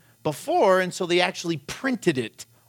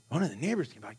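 quiet echo; 2 semitones lower, 8 dB quieter, much faster than usual. Retold or imitated. Dad repeats daughter's news.

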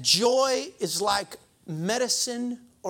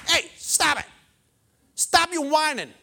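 scolding stop it; 6 semitones higher, 4 dB louder than usual. Retold or imitated. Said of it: "he basically tells the people".

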